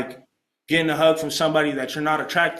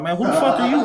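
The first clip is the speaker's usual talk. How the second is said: high, louder mock-indignant challenge; confrontational retort; 10 semitones higher, 5 dB louder than usual. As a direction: act out squaring up to a stranger. Retold confrontation with Joseph.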